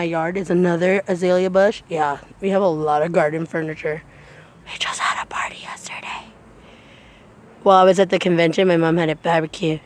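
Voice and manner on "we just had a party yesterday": soft unvoiced whisper. Real, not acted. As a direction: whisper it quietly. Whispered aside.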